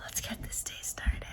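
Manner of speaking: soft unvoiced whisper. Real, not acted. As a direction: hush it to the waking dog. Whispering to the sleepy dog.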